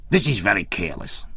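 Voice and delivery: lightly voiced fussy scolding; fussy exclamation. A narrator's reading. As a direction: tut at the carelessness. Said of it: "he exclaimed fussily".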